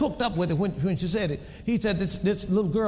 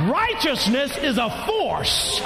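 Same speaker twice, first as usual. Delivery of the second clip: preaching shout; 9 semitones higher than usual. Acted. Preacher shouting that righteousness is a force. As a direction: hammer it home like a revival.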